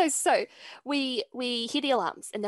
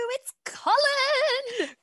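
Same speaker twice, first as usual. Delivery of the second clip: very slow, high and loud; drawn-out announcement; 14 semitones higher, 4 dB louder, much slower than usual. Real, not acted. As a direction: stretch the name like a big reveal. Announcing the special guest.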